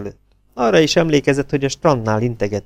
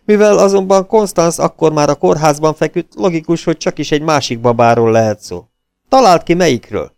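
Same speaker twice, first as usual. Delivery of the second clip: eager and louder, rushing the news out; can't wait to tell it, a bit higher; excited phone news; 6 semitones higher, 5 dB louder than usual. A narrator's reading. Rick on the phone telling Rocky the news, excited and louder.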